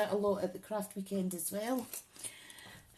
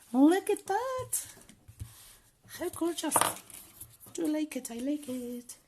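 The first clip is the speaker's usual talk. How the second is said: delighted; 10 semitones higher than usual. Real, not acted. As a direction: squeal with pleasure over your work. Admiring finished gift box.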